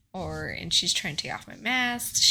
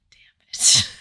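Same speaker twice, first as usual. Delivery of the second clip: whispered curse; 13 dB louder than usual. Retold or imitated. And I'm like.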